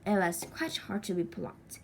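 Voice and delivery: ordinary delivery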